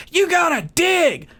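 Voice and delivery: high, slow yelled command; host shouting orders. Retold or imitated. Mimicking host Jeff yelling at exhausted castaways, high shout. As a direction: bark it like a drill sergeant host. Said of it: "Jeff's going".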